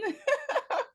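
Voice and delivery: bright giggle, high up; a quick high laugh; high light laugh. Real, not acted. Laughs right after greeting.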